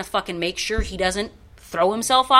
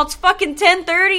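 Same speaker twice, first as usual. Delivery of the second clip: exasperated retort; 10 semitones higher, 5 dB louder than usual. Retold or imitated.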